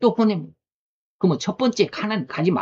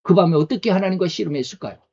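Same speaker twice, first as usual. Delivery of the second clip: ordinary talk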